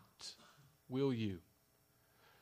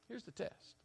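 soft repeat; 10 semitones higher, 10 dB quieter than usual. Shown as a demonstration.

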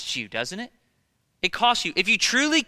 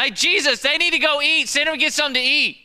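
higher, louder, working up the nerve to complain; blurting out; 8 semitones higher, 6 dB louder than usual. Retold or imitated. Irritated disciple complaining to Jesus, high and loud.

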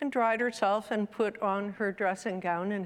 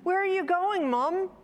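put-on high, louder child voice; child's question; 10 semitones higher, 4 dB louder than usual. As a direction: pipe up like a child asking Mum. Child questioning mother in story.

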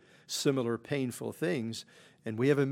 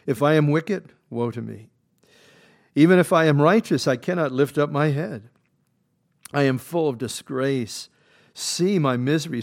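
scripture read at full force; 3 semitones higher, 10 dB louder than usual. Acted. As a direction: proclaim each line like a pulpit reading. Reads Job's plea.

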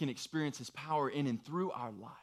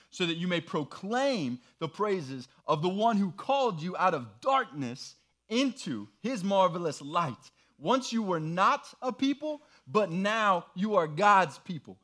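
raised, louder proclaiming read; scripture reading; 6 semitones higher, 6 dB louder than usual. Acted. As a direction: proclaim the verse to the room. Group rereads the passage together.

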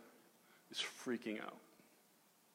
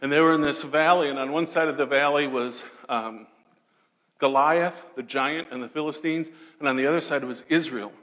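ordinary talk

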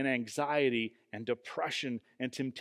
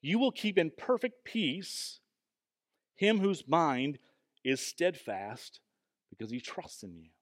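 scripture reading. Acted. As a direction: recite the verse unhurriedly, phrase by phrase. Repeats the verse slowly.

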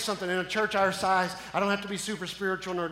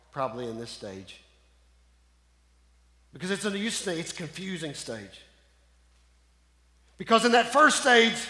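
ordinary talk

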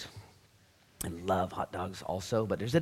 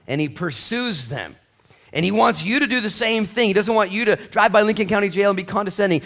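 loud, driving sermon, much higher; preaching at full force; 10 semitones higher, 12 dB louder than usual. Acted. Preacher urging the congregation not to look down on people, in full-force preaching. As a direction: preach it hard to the whole room.

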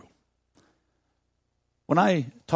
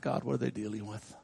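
soft, low inner prayer; hushed plea under the breath; quiet inner prayer; 4 semitones lower, 9 dB quieter than usual. Retold or imitated. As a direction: murmur it like a private thought to God. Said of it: "and think".

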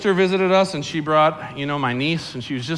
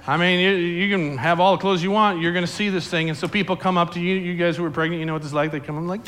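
ordinary delivery

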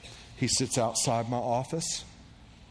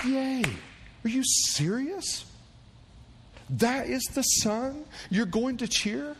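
high, slow mocking cheer; mock cheering mom; 12 semitones higher, slower than usual. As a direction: mimic the mom cheering her son, much higher. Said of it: "Like, you're going to go".

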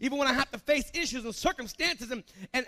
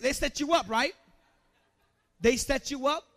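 usual speaking voice

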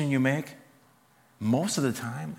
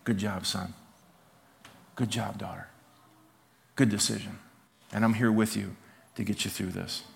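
gentle fatherly approval; 3 semitones lower, 4 dB quieter than usual. Acted. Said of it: "he's looking over going".

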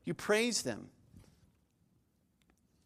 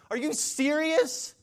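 very high, slow stretched question; incredulous outrage; 8 semitones higher, 3 dB louder, slower than usual. Acted. Preacher plays an aggrieved spouse challenging the advice, high incredulity. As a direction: draw it out in mock outrage. Acted spouse indignant at being told forgive.

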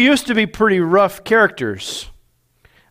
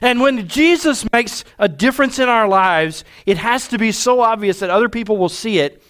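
raised preaching voice; 5 semitones higher than usual. Acted. Pastor exhorting the congregation, raised. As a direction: drive the point home from the pulpit.

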